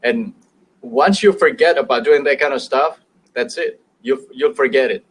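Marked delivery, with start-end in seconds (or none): none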